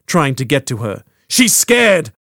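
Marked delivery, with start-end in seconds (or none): 1.3-2.1 high, loud pleading yell; desperate shout. Acted. Froy shouting at soldiers that Quintana is scared, desperate shout. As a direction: shout over the struggle to protect her. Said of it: "Froy shouted".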